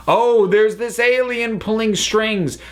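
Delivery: high, hammed-up gasp of a cheap twist reveal; mock reveal. Podcaster mocking a lazy alien twist, high mock reveal. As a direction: sing it up like a silly big reveal.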